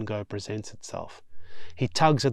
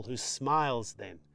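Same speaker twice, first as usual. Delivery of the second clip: usual speaking voice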